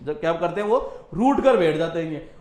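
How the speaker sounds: ordinary talk, nothing marked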